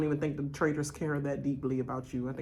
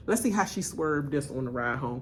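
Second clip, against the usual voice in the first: ordinary talk, nothing marked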